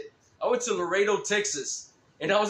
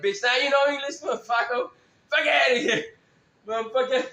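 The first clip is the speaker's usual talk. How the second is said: mocking disbelief; 6 semitones higher, 4 dB louder than usual. Mocking the guy's sudden English.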